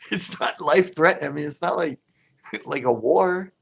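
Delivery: amused disbelief; exasperated. Real, not acted. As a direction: laugh it off as absurd. Mocking macho fishermen.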